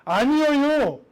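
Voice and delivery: 갈릴리 사투리로 살짝; Galilean accent. Retold or imitated. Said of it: "그 발음은 예루살렘에서 듣기에는 갈릴리 지방의 방언인 거죠".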